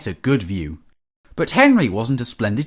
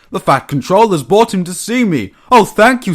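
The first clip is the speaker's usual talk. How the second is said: high, loud burst of gratitude; overjoyed, building to heartfelt thanks; gasping delight; 8 semitones higher, 8 dB louder than usual. A narrator's reading. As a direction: exclaim it high and thrilled. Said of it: "he gasped".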